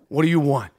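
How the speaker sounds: gruff pizzeria owner. Retold or imitated. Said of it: "Sal would ... be like".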